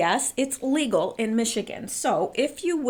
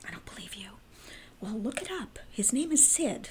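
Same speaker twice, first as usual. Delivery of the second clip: playful secret whisper; 9 dB quieter than usual. Pretend. Stage whisper to audience.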